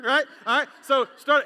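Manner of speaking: ordinary talk, nothing marked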